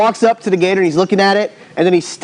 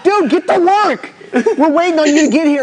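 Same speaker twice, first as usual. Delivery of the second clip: high-pitched, laughing retold scolding; exasperated with a friend, half laughing; mock exasperation; 11 semitones higher than usual. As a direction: chide a dawdling friend through laughter. Retelling own exasperated reply to Gary.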